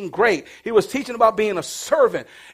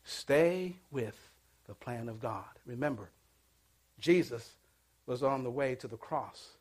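much lower and softer, slowing; hushed close; 9 semitones lower, 16 dB quieter than usual. Real, not acted. Preacher closing the sermon, softly pleading. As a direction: sink into a quiet appeal.

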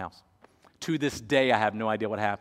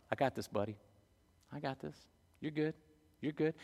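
put-on much softer, calming a frightened child; soft reassurance; 3 semitones higher, 9 dB quieter than usual. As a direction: hush a scared kid with quiet confidence. Dad reassuring scared son.

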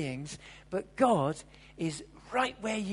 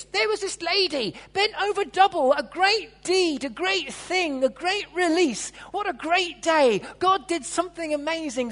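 much louder and higher, emphatic sermon; fiery preaching; 8 semitones higher, 9 dB louder than usual. Acted. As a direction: preach it out with full force. Preaching at full force.

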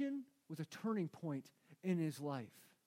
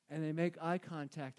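normal talking voice

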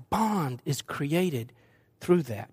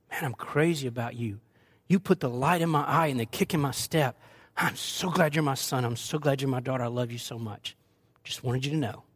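put-on tender to child; 3 semitones lower than usual. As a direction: say it gently, eye to eye. Parent speaking tenderly to child.

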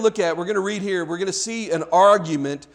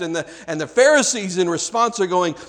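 normal talking voice

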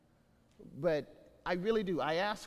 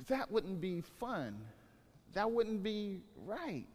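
slow emphasis; 4 dB quieter, slower than usual. Acted. Slow dismissive answer.

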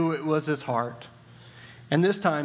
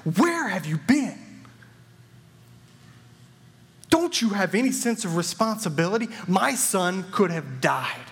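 stern demand; 4 semitones higher than usual. Acted. Frightened father confronting the late doctor, stern and rising. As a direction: lean in on the doctor, voice rising with anger. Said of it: "He asked the doctor sternly".

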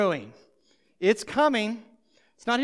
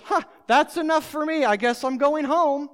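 lightly voiced bored listener, higher and louder; mock crowd member; 6 semitones higher, 7 dB louder than usual. Preacher imagining a crowd member leaving, light mock.

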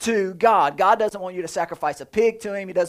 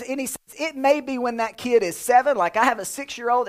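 usual speaking voice

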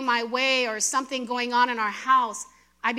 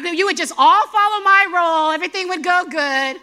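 mock bossy; 4 semitones higher, 7 dB louder than usual. Voicing a dominator.

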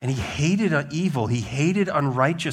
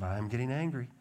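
soft, held-in mock anger; imitating simmering anger; 8 dB quieter than usual. Preacher replays the little guy to bring levity, softly simmering. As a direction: start the little boy's tantrum quietly. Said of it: "this little guy in our mind".

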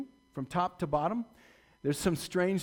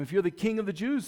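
calling out mockery; 5 semitones higher, 5 dB louder, faster than usual. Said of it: "They were calling out to him".

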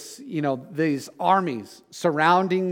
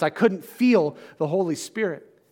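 usual speaking voice

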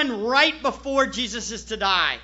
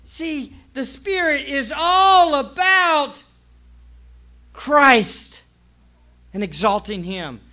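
full-force preaching; 3 semitones higher, 6 dB louder than usual. Acted. Preacher driving the point home to the church, full force. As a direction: preach it out to the congregation.

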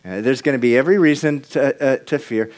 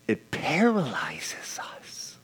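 hushed, slow whisper; paralyzing whisper; 9 dB quieter, slower than usual. Acted. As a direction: drop it to a breathy hush. Whispered point in sermon.

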